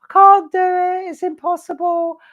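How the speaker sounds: high, whiny mock despair; hammed-up tantrum of a stuck puzzler; mock whining. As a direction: squeal it like a sulky kid giving up. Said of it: "most of you have thrown all your toys out the pram".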